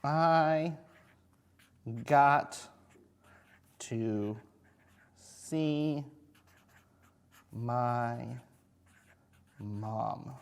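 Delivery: each word said slowly as written; slow dictation. Shown as a demonstration. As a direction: sound out each word as you write. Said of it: "I'm gonna write".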